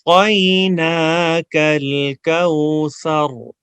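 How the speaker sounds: slow melodic chant with pauses; recitation to repeat. Shown as a demonstration. Teacher leads students to repeat after him, slow chant. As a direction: recite for the class to echo back. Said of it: "میں پاز لوں گا".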